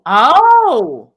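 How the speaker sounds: loud, high drawn-out oh; shocked exclamation. Real, not acted. Co-host watching the clip, loudly shocked.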